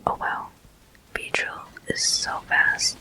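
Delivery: ordinary talk, nothing marked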